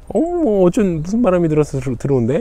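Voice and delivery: high, mocking sneer; mock him with a sharp high jeer. Wife jeering at her husband coming home, high sneer. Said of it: "이러면서 막 비아냥거려요".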